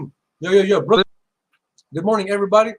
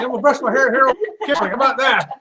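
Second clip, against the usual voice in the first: louder, laughing through words; 5 semitones higher, 5 dB louder than usual. Real, not acted. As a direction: chuckle while talking. Laughs about messy hair.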